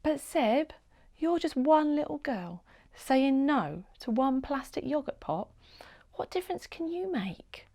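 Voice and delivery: soft, fading to a whisper; quiet doubt. A narrator's reading. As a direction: doubt her quietly, trailing off. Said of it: "said quietly".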